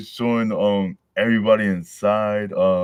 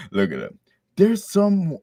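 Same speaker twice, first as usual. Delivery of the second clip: ordinary delivery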